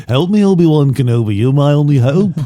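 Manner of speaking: a hint of a British accent; lightly put-on Brit plea. Host does Star Wars line in mild British voice. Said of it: "That's my Alec Guinness impression".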